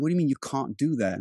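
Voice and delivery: CAN'T said with a long ah inside the question; can't with the vowel of "father"; British can't. Retold or imitated. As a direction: ask it with a British "cahn't". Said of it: "I kept saying "can't".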